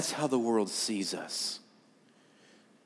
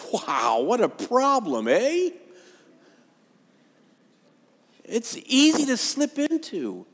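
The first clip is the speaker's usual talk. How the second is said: sarcastic; 10 semitones higher, slower than usual. Acted. Sarcastic self-mockery.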